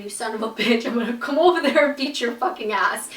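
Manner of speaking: mock furious threat. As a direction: threaten her as if imagining the fight. Imagined threat to the girl who kissed him.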